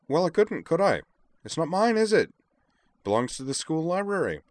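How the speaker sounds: very high, loud, squeaky protest; defensive Devlin. A narrator's reading. Devlin justifies himself to Moose, high and indignant. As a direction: protest in a character voice pitched far up.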